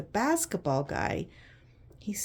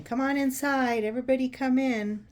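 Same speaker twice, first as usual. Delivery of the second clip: put-on calling the kids inside, louder and higher; raised teacher's call across the yard; 6 semitones higher, 6 dB louder than usual. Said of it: "the teacher saying".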